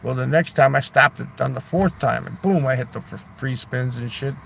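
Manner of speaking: normal talking voice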